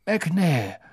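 gently mocking question; old headmaster's voice. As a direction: headmaster teasing the executioner. Dumbledore voiced.

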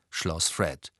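ordinary delivery, nothing marked

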